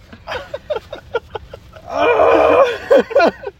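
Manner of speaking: shrill laugh at himself; squealing laugh through pain; high pained laugh. Real, not acted. Laughing at own mishap.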